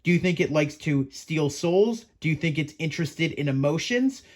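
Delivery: ordinary delivery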